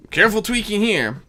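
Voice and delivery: usual speaking voice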